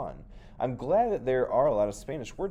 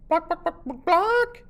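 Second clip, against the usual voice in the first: very high, loud clucking; hen clucking; 10 dB louder, slower than usual. Acted. The hen answering Nieto with high clucks. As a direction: squawk it out like a hen.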